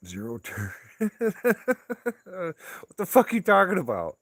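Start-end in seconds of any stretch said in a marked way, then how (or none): 0.8-2.2 sudden high cackling laugh; loud high laugh burst. Real, not acted. Laughing out loud at a viewer's mower comment. 2.2-4.2 high, loud disbelieving yell; incredulous shout. Real, not acted. Yelling at a viewer who suggested a zero turn.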